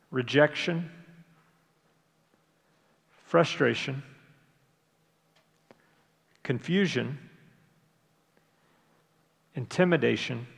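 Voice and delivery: unhurried, each emotion left to hang; slow list reading. Real, not acted. Pastor reading emotions for listeners to count, slowly. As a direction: read slowly, a long pause after each word. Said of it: "I'll read 'em fairly slowly".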